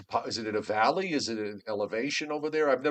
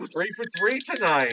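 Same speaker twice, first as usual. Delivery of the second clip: excited; 8 semitones higher, 6 dB louder than usual. Real, not acted. Thrilled at third correct guess.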